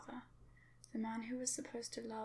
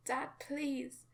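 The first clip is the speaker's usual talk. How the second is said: higher, louder tearful plea; crying scream; 4 semitones higher, 5 dB louder than usual. Acted. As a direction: scream for your father through tears. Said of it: "Crying, I screamed".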